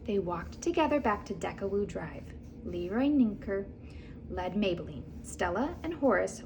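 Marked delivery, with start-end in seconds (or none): none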